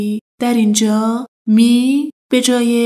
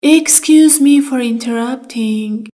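normal talking voice

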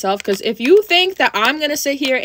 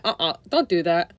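lower, firmer warning; 6 semitones lower, 3 dB quieter than usual. Retold or imitated. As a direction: lower firm "Uh-uh, don't do that". Repeats the warning.

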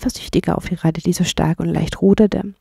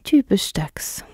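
disappointed; slower than usual. Acted. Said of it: "sagte die enttäuschte Ratte".

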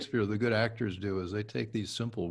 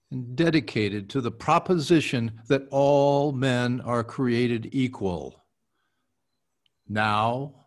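formal speech recitation; 7 dB louder than usual. Acted. Actor restarting the Gettysburg Address for his coach, solemn. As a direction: let each phrase land like history.

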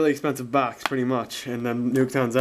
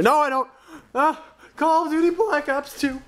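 can't contain the excitement; excited yelling; 12 semitones higher than usual. Real, not acted. Gamer holding his new game, yelling excitedly.

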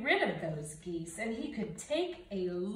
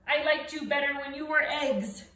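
louder, grumpy scolding; stern; 4 semitones higher, 6 dB louder, faster than usual. Acted. Bear telling off the goslings, stern and louder. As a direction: grumble it loud at them. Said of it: "Bruce was very stern".

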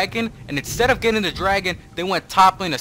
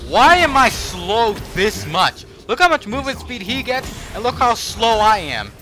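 higher-pitched, incredulous whining complaint; frustrated rant; 8 semitones higher than usual. Real, not acted. Streamer ranting that Malphite outruns him, frustrated. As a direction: vent the injustice, fed up. Ranting about being slowed.

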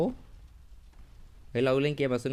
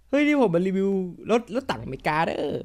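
put-on joking Isan accent; 5 semitones higher than usual. Gamer jokingly announces a car review in an Isan accent. Jokes in Isan-style dialect.

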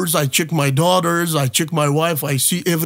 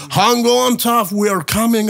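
raised, higher mock-Texan call; rough Texan voice; 6 semitones higher than usual. Dan calling to his drowning friend in a rough Texan voice. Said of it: "in this very rough Texan voice".

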